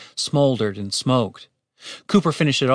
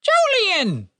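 high-pitched alarmed cry; urgent bird voice, pitched way up; alarmed raven. A narrator's reading. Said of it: "with alarm in its voice".

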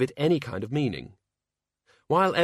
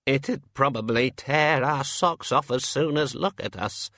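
slow, higher fearful wail; 3 semitones higher, slower than usual. A narrator's reading. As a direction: lament your doom, drawing it out. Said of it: "wailed the senior wrangler".

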